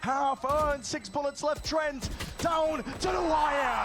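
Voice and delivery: slow, rising play-by-play call; esports caster. Real, not acted. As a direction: drag out the clutch call, building. Caster calling a tense clutch.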